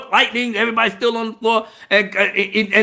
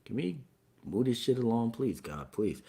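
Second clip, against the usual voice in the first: much softer, weary plea; bored pleading; 9 semitones lower, 15 dB quieter than usual. Retold or imitated. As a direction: mutter a tired plea to God. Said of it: "I'm sitting up here like this".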